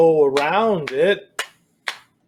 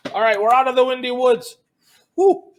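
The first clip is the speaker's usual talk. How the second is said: playful relief; 9 semitones higher, 4 dB louder, faster than usual. Pretend. Relief leaving windy woods.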